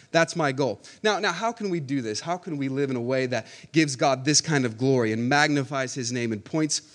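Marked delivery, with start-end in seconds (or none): none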